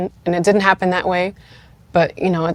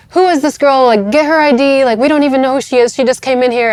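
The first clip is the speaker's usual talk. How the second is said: higher, louder yelling, imitating hospital staff; 7 semitones higher, 4 dB louder than usual. Hospital staff yelling at the unknown woman in labor, imitated higher and louder. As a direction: mimic the staff shouting at her. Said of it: "they're just, like, yelling at me".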